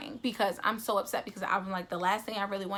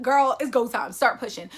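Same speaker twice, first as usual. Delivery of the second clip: pep talk; 3 semitones higher, 7 dB louder than usual. Retold or imitated. Psyching herself up.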